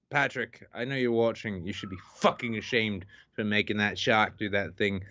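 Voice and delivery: normal talking voice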